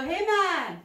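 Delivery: usual speaking voice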